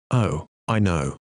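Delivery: low, slow, understated sadness; sad. A narrator's reading. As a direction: sink gently into a sad admission. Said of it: "said Eva sadly".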